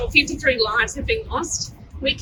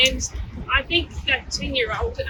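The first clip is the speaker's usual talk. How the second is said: ordinary delivery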